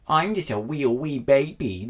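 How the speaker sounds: put-on slow babyish wee voice. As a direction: play the tiny baby. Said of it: "No, that's my baby voice".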